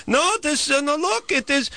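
high, halting mock Israeli accent; horrible Israeli accent. Neighbor pointing out the leak, mock Israeli accent. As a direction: falsetto neighbor insisting, Israeli-accent caricature. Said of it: "It's a horrible accent".

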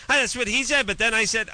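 high-pitched, whiny-caller imitation; mock protesting caller. Host mimics callers who argue back, mock protesting.

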